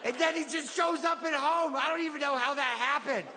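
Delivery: put-on high, singsong little-kid voice; child retelling a storybook. Kid sharing the favorite book mom got, childlike storytelling.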